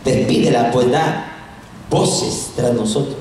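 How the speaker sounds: low, subdued plea; disciples pleading. Acted. Disciples asking the Lord to dismiss the woman, low plea. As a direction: ask low, dragging the last words. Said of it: "le rogaron diciendo".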